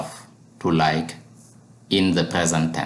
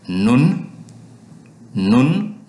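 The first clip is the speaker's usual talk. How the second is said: ordinary delivery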